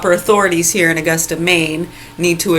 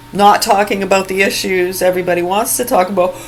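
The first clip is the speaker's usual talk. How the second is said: ordinary delivery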